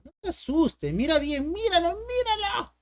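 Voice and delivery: climbing to a high-pitched "look at it"; playful urging. Acted. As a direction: urge the scared student, pitch rising sharply. Playfully urging a scared student to look.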